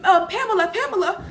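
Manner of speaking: high-pitched tot reporting pretend danger; imitating small child. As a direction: play a child raising the alarm. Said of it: "she'd be like".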